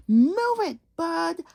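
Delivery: loud high bossy shout; grocery truck shouting rudely. Acted. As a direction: push everyone aside with it. Rude grocery truck honking.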